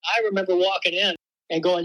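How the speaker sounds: ordinary talk, nothing marked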